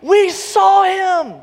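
very high, loud joyful shouting; excited shout. Acted. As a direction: disciples bursting with news. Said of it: "they say".

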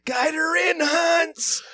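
high, strained mock call from the air; panicked parachute call-out. Performer to partner while coming in by parachute, high mock calling. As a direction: call out high as you come drifting down.

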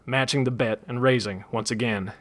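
usual speaking voice

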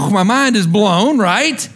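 ordinary talk

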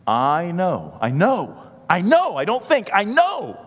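rising from loud to high, loud preaching; building preach. Acted. Pastor crying out I know to the congregation. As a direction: build it up to a peak.

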